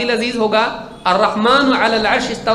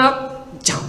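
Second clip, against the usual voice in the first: whispered, higher, sly go-ahead; whispered flattery; 5 semitones higher, 4 dB quieter, slower than usual. Pretend. Flatterer telling the traveller to go on, whispered. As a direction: breathe it out like a sly yes-man.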